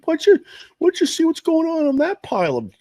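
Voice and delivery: high, loud put-on dad voice; imitated dad. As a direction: call it out as a hammed-up pushy dad. Acting out his dad at dump.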